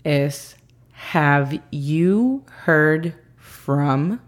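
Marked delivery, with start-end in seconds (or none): 1.1-4.2 very slow careful English demo; teacher model, very slow; slow model phrase. Shown as a demonstration. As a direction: stretch every word for learners. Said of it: "La segunda frase del día es".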